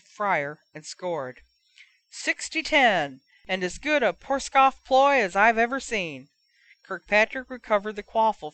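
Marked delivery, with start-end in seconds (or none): 2.1-6.3 higher announcer call of the score; commentator. Acted. Match commentator voice.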